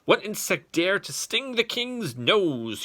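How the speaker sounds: king's shout. Acted. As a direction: bellow it as the stung king. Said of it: "he shouted".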